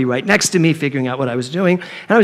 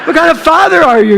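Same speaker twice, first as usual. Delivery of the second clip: exasperated outburst; 6 semitones higher, 4 dB louder than usual. Retold or imitated. Mother fed up with her minister husband, loud and exasperated. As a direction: cry out at him, fed up and upset. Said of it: "And I was like".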